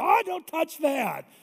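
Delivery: high, slow, whiny protest; kids protesting. Retold or imitated. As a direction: squeal like a kid guarding his hat.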